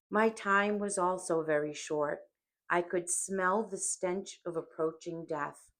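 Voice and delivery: usual speaking voice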